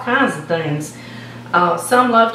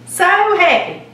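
gushing; 9 semitones higher, slower than usual. Real, not acted. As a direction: burst out with joy on the repeat.

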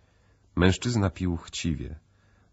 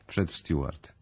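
usual speaking voice

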